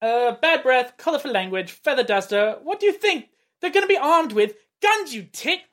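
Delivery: put-on British accent rising to an indignant "you tit"; British accent line reading. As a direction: read the line as a cheeky British gangster. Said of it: "get [name] to read Eddie's line".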